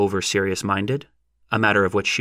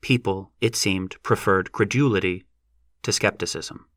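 plain reading voice

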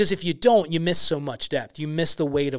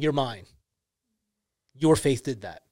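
soft and lower, gently reassuring; tender Jesus; 4 semitones lower, 3 dB quieter than usual. Retold or imitated. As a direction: speak it like a quiet blessing. Voicing Jesus restoring her.

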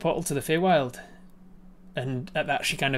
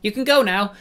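higher, louder command; sprite dismisses gorilla; 8 semitones higher, 8 dB louder than usual. Acted. Sprite dismisses the gorilla, higher. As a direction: send him off sweetly. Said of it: "she turns to him and says".

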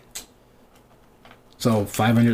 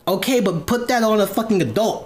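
exasperated; 7 semitones higher than usual. Retold or imitated. Acted exasperated reply in retold argument.

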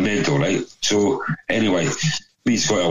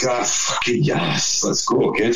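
long drawn-out, higher-pitched 'yes'; retold elation; 4 semitones higher, slower than usual. Mates hear of the free bar, elated. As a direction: relish the good news, stretching it out.

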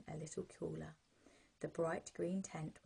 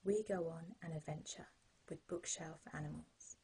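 sleep story narrator; 21 dB louder than usual. Acted.

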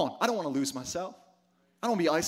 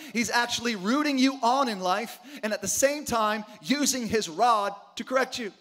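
normal talking voice

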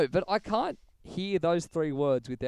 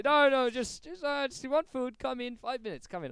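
much higher wheedling shopkeeper; Abdullah; 11 semitones higher, 4 dB louder than usual. Retold or imitated. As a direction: plead him back in, high and coaxing. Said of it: "He talked over the door".

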